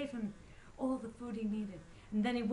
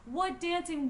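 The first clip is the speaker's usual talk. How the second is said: plain reading voice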